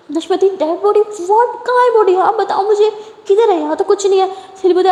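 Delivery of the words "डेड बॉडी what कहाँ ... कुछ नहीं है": high, startled questions; high-pitched surprised asking; shocked disbelief. A narrator's reading. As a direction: react as if you can't believe it. Lakshmi shocked by the news.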